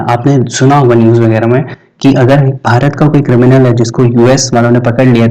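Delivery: ordinary talk, nothing marked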